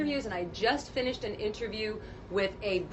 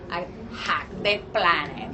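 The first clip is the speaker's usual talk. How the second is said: character voice line; 4 semitones lower than usual. Shown as a demonstration. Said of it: "I could do a couple".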